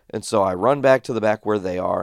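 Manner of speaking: ordinary delivery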